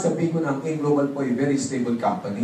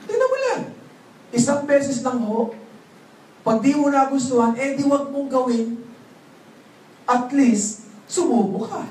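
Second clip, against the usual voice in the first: eager pleading recruiter; 8 semitones higher than usual. Retold or imitated. Recruiter pleading to try once.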